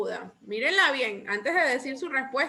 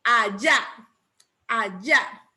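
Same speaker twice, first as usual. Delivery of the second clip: Allá with the final YÁ punched; Allá, second syllable emphasized and rising; stress on Allá's last syllable; 2 semitones lower, 3 dB louder, slower than usual. Shown as a demonstration. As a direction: land hard on the end of Allá.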